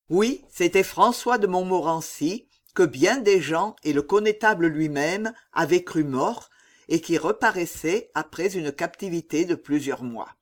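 ordinary delivery

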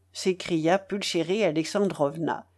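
usual speaking voice

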